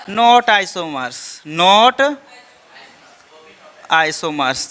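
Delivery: very slow, each word stretched for the class to repeat; slow drawn-out answer. Shown as a demonstration. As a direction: draw it out like a chant for students. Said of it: "क्या ये चौथा isomers होगा?". (बहुत धीरे, खींचकर बोला गया जवाब)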